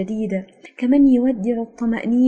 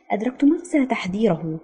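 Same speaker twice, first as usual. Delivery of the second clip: ordinary delivery, nothing marked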